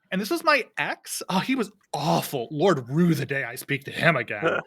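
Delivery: high, loud gushing voice; Camellia voice. Acted. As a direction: ham it up like a gossip. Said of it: "Camellia is being like".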